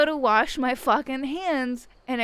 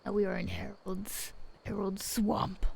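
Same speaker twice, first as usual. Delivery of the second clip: whisper; 3 semitones lower, 7 dB quieter than usual. Real, not acted. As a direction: breathe it like a secret place. Entering the character's realm.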